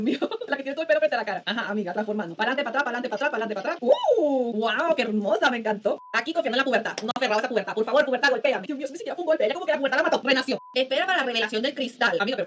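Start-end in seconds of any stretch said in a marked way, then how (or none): none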